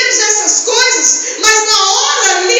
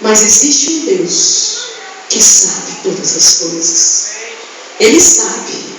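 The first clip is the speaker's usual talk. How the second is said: low intimate preaching; 9 semitones lower than usual. Acted. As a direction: draw them close with a low voice.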